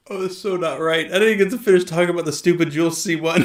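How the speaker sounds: loud, fast, through laughter; rushed words breaking into real laughter; laughing protest. Real, not acted. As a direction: protest while cracking up. Losing it over the Boston bit.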